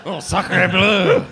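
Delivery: put-on hammy slow French accent; exaggerated French accent exclamation; mock French accent. Mocking French joke.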